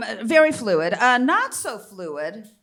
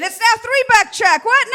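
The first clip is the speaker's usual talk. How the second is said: loud, high outrage; comic outrage; indignant shout; 10 semitones higher, 10 dB louder than usual. Real, not acted. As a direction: rail at the price hike. Outrage at inflation.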